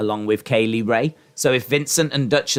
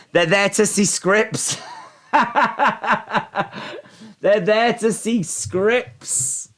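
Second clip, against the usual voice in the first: mock voice laughing; 8 semitones higher, slower than usual. Jokey put-on voice, laughing.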